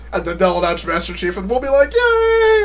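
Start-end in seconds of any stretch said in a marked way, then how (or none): 1.9-2.6 very high mock yay; high-pitched squeal of pretend delight; imitated fan cheering. Streamer imitating fans at a Master Chief announcement, high cheering.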